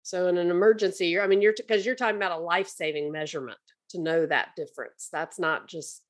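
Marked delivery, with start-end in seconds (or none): none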